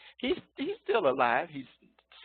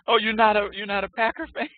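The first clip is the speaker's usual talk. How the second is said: high, loud mock disbelief at the student; playful gasp of disbelief; mock surprise; 10 semitones higher, 12 dB louder than usual. Real, not acted. Teacher teasing a Green Bay student, mock surprised.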